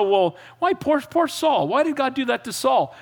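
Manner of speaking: high, plaintive mock complaint; mock pity. Naive Bible reader feeling sorry for Saul, mock pitying.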